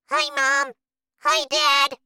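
put-on tiny, squeaky-high boy; very small voice. A narrator's reading. Shrunken boy greeting his parents in a tiny voice. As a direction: pipe up like a miniature child. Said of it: "in a very small voice".

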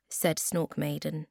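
ordinary delivery, nothing marked

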